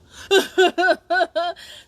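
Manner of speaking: hearty burst of laughter; loud laugh. Real, not acted. Laughs at own joke.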